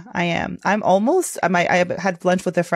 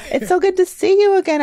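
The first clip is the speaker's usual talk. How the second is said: excited greeting; 12 semitones higher, 4 dB louder than usual. Real, not acted. Greeting co-host back.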